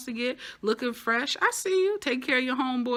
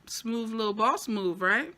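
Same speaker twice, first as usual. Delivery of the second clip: ordinary talk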